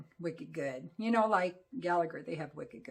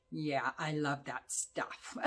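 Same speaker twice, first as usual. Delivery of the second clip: slow, lower, savoring delivery; dark relish; 4 semitones lower, 5 dB quieter, slower than usual. Real, not acted. Reviewer admitting a love of gothic creepy dark things, with relish.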